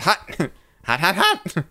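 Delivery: Ruby Rhod. Pretend. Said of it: "That was terrible, uh, Ruby Rod".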